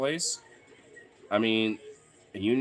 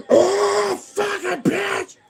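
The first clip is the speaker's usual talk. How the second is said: reenacted scream of pain, way higher and louder, stretched slow; 9 dB louder, much slower than usual. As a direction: yell it like you just cracked your wrist. Retelling hurting his hand.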